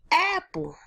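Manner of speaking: high, bright yelled word; mock yell. Shown as a demonstration. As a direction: yell it like an eager kid. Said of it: "Yell it".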